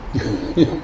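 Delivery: soft, short self-amused laugh; brief chuckle. Real, not acted. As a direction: chuckle quietly at your own excuse. Chuckles at his age excuse.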